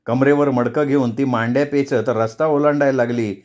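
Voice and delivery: plain reading voice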